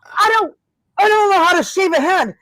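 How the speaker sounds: high, flustered panic at the referee; mock panic, voice jumping up high; panicked self. Retelling her panic at the referee, high and flustered. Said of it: "And I'm like".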